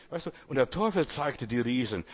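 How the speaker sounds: ordinary talk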